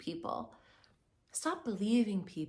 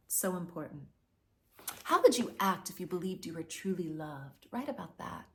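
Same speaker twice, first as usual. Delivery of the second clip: normal talking voice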